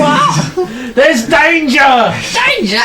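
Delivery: high-pitched hollered exchange; comic shouting. Acted. Gnome couple pass on the alarming news, high-pitched shouting. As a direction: play two old gnomes yelling back and forth.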